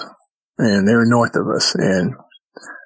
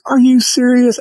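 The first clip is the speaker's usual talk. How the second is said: much higher and louder, a stunned squeak of a question; shocked disbelief; 13 semitones higher, 5 dB louder than usual. Retold or imitated. Bruce hearing Bigfoot is behind the tree, high shocked question. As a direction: blurt it out in high-pitched astonishment.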